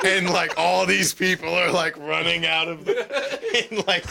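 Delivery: words breaking into laughter; laughing through the story; laughing while telling. Real, not acted. Retelling a TV scene to friends, laughing as he talks.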